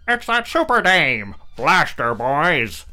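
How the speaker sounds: loud, high gloating order; gangster boss. Acted. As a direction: bark the attack order over a loudspeaker. Tank boss over loudspeaker.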